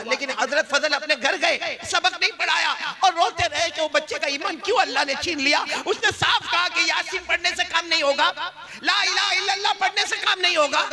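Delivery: high, anguished pulpit delivery; full-force preaching. Real, not acted. Preacher telling of teacher's grief for his student, impassioned. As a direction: sustain a high, driving cry.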